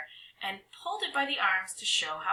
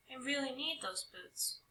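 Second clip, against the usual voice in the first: much softer, gentle reassurance; reassuring; 5 semitones higher, 11 dB quieter than usual. Acted. Girl gently reassures the twin trolls, soft. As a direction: soothe the twin trolls softly. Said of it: "I said reassuringly".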